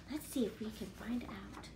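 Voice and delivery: soft unvoiced hush; whisper. Real, not acted. Teacher whispering to the children before the reveal. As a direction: whisper to build suspense.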